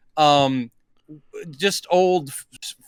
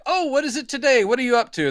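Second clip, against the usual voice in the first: playing along; 9 semitones higher, faster than usual. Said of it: "He's like".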